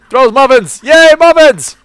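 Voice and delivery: excited cheer. Real, not acted. Cheering at muffin-throwing item.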